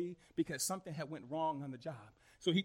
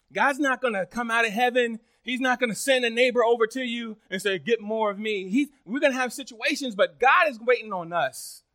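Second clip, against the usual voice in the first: loud, raised, insistent sermon; preaching at full force; 4 semitones higher, 10 dB louder than usual. Real, not acted. Preacher telling church God is waiting on them, preaching forcefully. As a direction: press it on the congregation.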